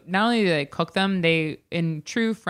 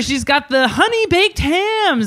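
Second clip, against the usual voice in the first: high, slow, put-on gossip voice; hammed-up catty shopper pointing out a rival; mock shopper; 10 semitones higher, 7 dB louder, slower than usual. Friend mimicking a supermarket sweep shopper, high and slow.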